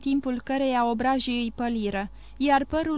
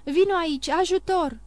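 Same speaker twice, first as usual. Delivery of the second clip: raised, higher-pitched plea for help; cry for help; 6 semitones higher, 3 dB louder than usual. A narrator's reading. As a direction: call out urgently for help. Valentin calls out for help. (strigăt de ajutor)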